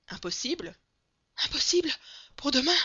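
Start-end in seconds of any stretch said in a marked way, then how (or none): 1.4-2.8 high, very loud, urgent outburst; frantic insistence. A narrator's reading. Breathless servant telling his master of the wedding, frantic. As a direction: cry it out in agitated short bursts.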